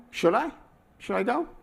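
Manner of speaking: doubtful asking should I go; uncertain, questioning. Shown as a demonstration. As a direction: ask yourself, unsure. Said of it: "And the last one is".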